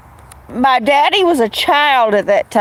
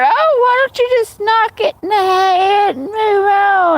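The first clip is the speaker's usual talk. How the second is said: put-on high sneering old-timer voice; mocking old man; 10 semitones higher than usual. Old men scoffing about the cat, high mocking voice.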